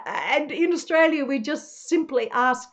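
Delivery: ordinary talk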